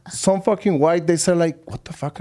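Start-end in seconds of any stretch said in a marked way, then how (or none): 1.7-2.2 mock-appalled, near-voiceless mutter; hushed disbelief. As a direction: imitate a put-off listener under the breath. Retold reaction to being called love.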